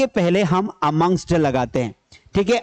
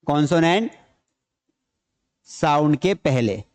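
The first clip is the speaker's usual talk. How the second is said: slow dictation; 4 semitones lower than usual. Shown as a demonstration. Teacher dictating the rule to students, slow and broken up. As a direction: split into pieces with long pauses. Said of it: "ये कब आता है?".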